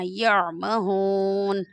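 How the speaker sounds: यामहून very slow with a clear throat ain; correct ain. Shown as a demonstration. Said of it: "आइन को सही से आपने अदा करना है".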